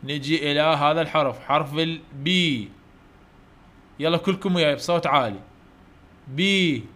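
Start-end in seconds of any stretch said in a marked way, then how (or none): 1.4-2.7 البي said slowly and drawn out, clearly; slow letter model. Shown as a demonstration. Teacher modelling the letter B slowly for pupils. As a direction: stretch حرف البي for children to copy.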